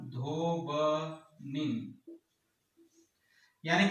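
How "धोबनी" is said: धोबनी stretched very slow, almost sung; drawn-out answer. Shown as a demonstration. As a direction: draw out धोबनी slowly, syllable by syllable. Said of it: "क्या बनेगा?". (धोबनी को बहुत धीरे, खींचकर, गाते हुए से बोलें)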